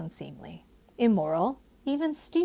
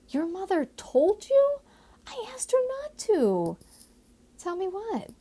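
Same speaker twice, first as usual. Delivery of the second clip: put-on higher young woman's voice, startled; lightly voiced Molly; 12 semitones higher than usual. Molly startled by Josiah's guess, higher anxious voice. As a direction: play the worried daughter, a touch anxious.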